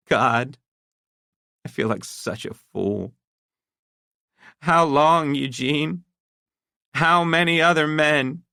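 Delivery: high, wounded wife fighting tears; a humiliated wife with a quivering lip; hurt, near tears. A narrator's reading. Wife to her husband, hurt and near tears. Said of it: "Her lip quivered".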